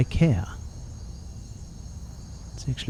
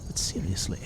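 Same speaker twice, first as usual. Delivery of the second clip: whispering; 5 semitones lower, 12 dB quieter than usual. Real, not acted. Muttering alone while playing.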